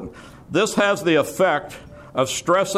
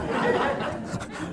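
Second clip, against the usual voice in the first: quiet chuckle; 8 dB quieter than usual. Real, not acted. Teasing "you think I'm kidding.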